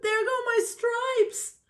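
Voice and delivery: high-pitched zebra yelp; high, startled storybook yell; yelled alarm. A narrator's reading. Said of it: "The zebra yelled".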